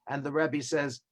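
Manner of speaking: ordinary talk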